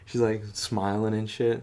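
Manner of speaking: ordinary talk, nothing marked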